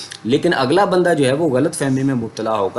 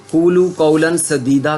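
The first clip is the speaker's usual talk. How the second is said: slow, higher, louder chanted recitation of the verse; Quranic recitation; 5 semitones higher, 5 dB louder, slower than usual. Shown as a demonstration. Teacher reciting the verse to his students, slowly intoned.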